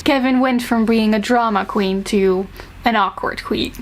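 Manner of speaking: normal talking voice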